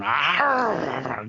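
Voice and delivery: drawn-out imitated beast growl. Retelling a comic where Jack lurches in, imitated growl.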